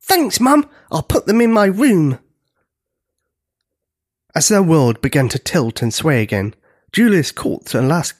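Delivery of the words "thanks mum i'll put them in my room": put-on high, pleased young voice; high-pitched delighted kid; excited child giant. A narrator's reading. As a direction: thank Mum eagerly.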